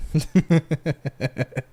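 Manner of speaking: amused giggling burst; laugh. Real, not acted. Laughing about her wild stories, amused giggle. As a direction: chuckle in a quick run.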